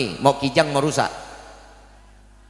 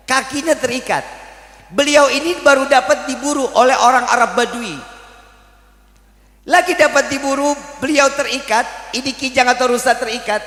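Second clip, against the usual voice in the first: raised, higher preaching voice; 8 semitones higher than usual. Acted. Preacher teaching the congregation, raised preaching voice. Preacher narrates the deer story.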